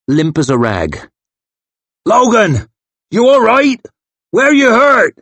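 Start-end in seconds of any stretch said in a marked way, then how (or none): 2.0-5.1 big man calling out, much higher and louder; worried shout. Acted. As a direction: bellow his name to wake him up. Big man calling to fallen Logan.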